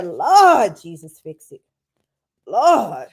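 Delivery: loud, slow cry out to Jesus; hammed-up call for the Lord to fix it; exasperated churchy wail; mock plea to the Lord.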